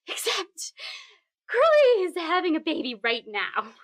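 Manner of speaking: high, strained fake calm over rising panic; forced casual panic. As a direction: pretend all is fine while panicking. Frantic phone call to boss.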